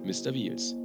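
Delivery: ordinary delivery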